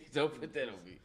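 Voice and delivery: laughing through the words; chuckling protest. Real, not acted. Laughing off a joke.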